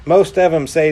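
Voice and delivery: usual speaking voice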